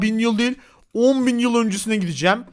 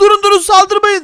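higher, louder stop, don't attack; panicked shout; 8 semitones higher, 7 dB louder than usual. Acted. Player begs attacking cavemen to stop, shouting. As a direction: yell to stop the mob.

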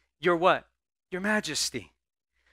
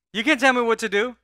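higher, louder sassy defiance; defiant attitude; 7 semitones higher, 8 dB louder than usual. Retold or imitated. Preacher mimicking today's rebellious attitude, loud and sassy. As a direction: toss it back like a rebellious teen. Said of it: "That's today's attitude".

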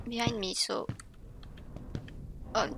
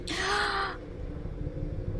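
sharp frightened gasp, high; startled gasp; 9 semitones higher, 5 dB quieter than usual. Real, not acted. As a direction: gasp as if jump-scared. Jump scare by a statue.

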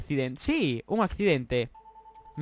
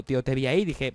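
normal talking voice

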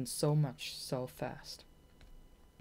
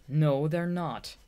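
louder, slow, stung retort; hotly; 8 dB louder, much slower than usual. Acted. Shade stung by the runt remark, hot slow denial. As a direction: bite back, offended. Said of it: "said Shade hotly".

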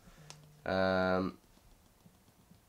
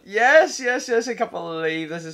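ecstatic shouting; 9 semitones higher, 10 dB louder, slower than usual. Real, not acted. Winning a close game battle.

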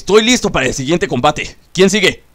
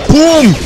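mock explosion; 10 semitones higher, 6 dB louder than usual. Finishing blow on Tsunade.

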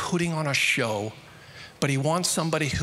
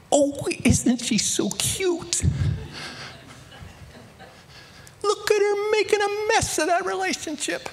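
much higher, slow, half-whispered fond cooing; doting coo. Pretend. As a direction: coo over a child making a mess. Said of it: "I just go".

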